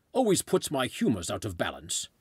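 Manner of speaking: hammed-up British old-chap voice; put-on British officer accent; mock British accent. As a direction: bluster like a British colonial gent. Continuing the British officer act.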